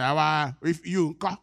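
play-acted angry outburst; mock angry. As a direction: bristle loudly at being corrected, acted out. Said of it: "don't be angry".

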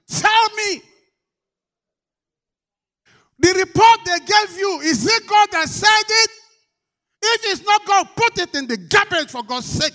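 loud, high ringing sermon; preaching at full force. Acted. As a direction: preach it with fire.

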